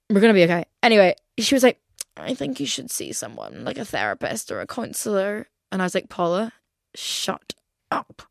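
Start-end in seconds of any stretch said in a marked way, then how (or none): 2.2-5.5 a lowered, hushed imitation of mom. Mom suggesting therapy again. 6.1-8.2 whispered retort. Retold or imitated. Daughter brushing off her mom, whispered.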